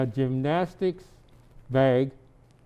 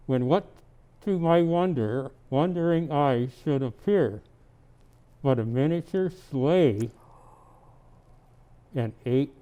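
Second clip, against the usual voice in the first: reading a Christmas poem aloud; gentle storybook reading; story narration; 2 semitones higher than usual. Acted. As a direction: read it like a bedtime tale to a child. Santa reads poem to child.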